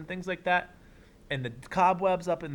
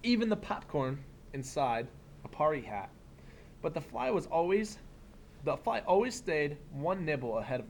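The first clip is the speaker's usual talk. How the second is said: put-on reading to children; 3 dB quieter than usual. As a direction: read it like story time for a class. Reads picture book aloud.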